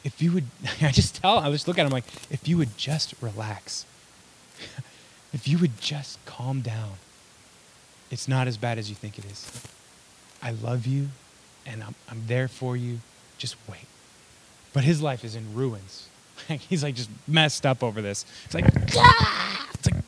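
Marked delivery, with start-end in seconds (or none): 2.3-13.8 put-on soft, unhurried reassurance to a baby; calm a crying child, sinking quieter; tender fatherly hush; soothing dad. Dad soothing his screaming baby. 19.0-19.7 high, loud infant wail; mock high-pitched cry; imitated baby cry. Imitating the baby's cry again.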